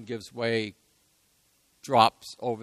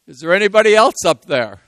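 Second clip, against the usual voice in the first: loud comic punchline; 7 semitones higher, 13 dB louder than usual. Retold or imitated. Punchline of cliff joke.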